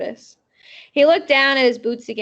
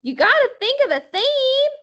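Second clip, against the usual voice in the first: put-on much higher, bossy little girl; 13 semitones higher, 4 dB louder than usual. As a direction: boss them like a tiny kid. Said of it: "my Sweetie Pie Thomas voice".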